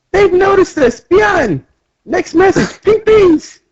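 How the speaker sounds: very high cartoon voice; high-pitched mock alarm; little fighter. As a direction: pipe up urgently in a tiny voice. Acted small fighters calling out.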